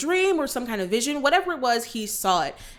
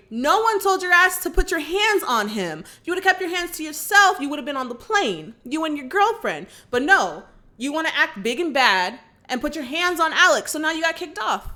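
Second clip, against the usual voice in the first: raised, higher-pitched telling-off; indignant scolding; 6 semitones higher, 4 dB louder than usual. Real, not acted. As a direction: tell the bully off, exasperated and emphatic. Teller scolds the bully Carter.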